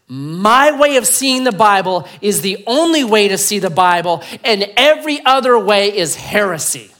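lightly voiced hardliner, loud and high; dogmatic believer. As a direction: proclaim it as the only truth.

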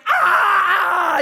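loud, high, drawn-out scream of pain; mock scream. Roommate sprayed in the face, screaming. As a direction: scream high and long, imitating the victim.

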